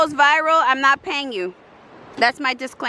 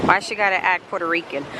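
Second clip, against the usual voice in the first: normal talking voice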